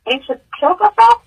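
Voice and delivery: high, rising stunned question; incredulous, voice climbing sharply at the end; disbelief. Retold or imitated. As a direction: echo the news in pained disbelief. Retelling her disbelieving question to Red.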